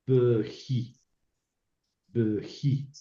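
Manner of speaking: bji said slowly as a model word; Hebrew word demo. Shown as a demonstration. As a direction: pronounce bji carefully for students. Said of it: "por ejemplo".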